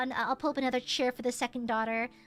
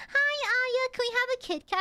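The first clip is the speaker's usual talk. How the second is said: imitating teen daughters; 8 semitones higher than usual.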